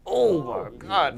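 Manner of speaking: high, long drawn-out astonishment; mock internal squeal of awe, slowed right down; inner freak-out. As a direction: stretch it out, starstruck. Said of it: "But in the inside, I'm just like".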